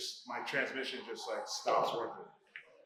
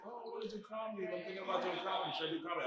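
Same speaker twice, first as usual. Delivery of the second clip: high, quick, soft newbie voice; mock a hopeful beginner; eager newbie; 8 semitones higher, 5 dB quieter, much faster than usual. Imitating eager aspiring comic.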